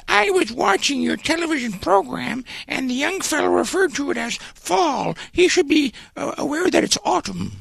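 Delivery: put-on high-pitched, prim old lady voice; put-on high, fluty complainer; lady caller. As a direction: mimic a fussy old lady's complaint in falsetto. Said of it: "a lady called ... left a very long voicemail".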